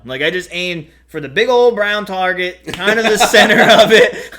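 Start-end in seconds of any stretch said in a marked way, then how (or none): 2.7-4.3 talking through loud laughter; laughing. Real, not acted. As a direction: laugh out the words. Laughing mid-story.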